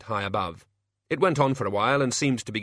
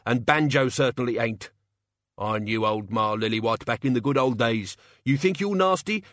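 scornful working man's rant; defiant old locksmith; 2 semitones higher than usual. Acted. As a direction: play a locksmith standing up to threats. Locksmith's defiant, menacing tirade.

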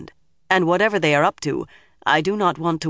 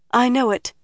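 higher, bravely held together; quick brave answer, pitched up; nearly steady voice; 8 semitones higher than usual. A narrator's reading. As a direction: keep the nerves out of it. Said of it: "proud that her voice hardly shook at all".

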